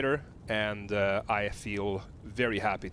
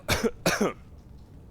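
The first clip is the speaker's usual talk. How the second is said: chili cough; 7 semitones higher than usual. Real, not acted.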